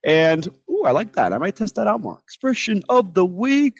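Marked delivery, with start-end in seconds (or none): 2.2-3.7 playful announcer, varied. Pretend. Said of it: "just to vary it".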